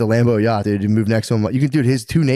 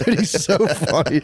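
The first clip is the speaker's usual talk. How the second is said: usual speaking voice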